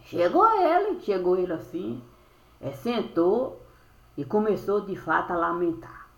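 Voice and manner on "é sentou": ordinary talk, nothing marked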